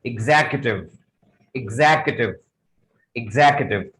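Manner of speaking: "एग्जीक्यूटिव" said "ig-ZAK-yu-tiv"; stressed "zak" in "एग्जीक्यूटिव"; correct pronunciation demo. Shown as a demonstration. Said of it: "जो सही pronunciation है". ("एग्जीक्यूटिव" में "इग्ज़ैक" पर ज़ोर)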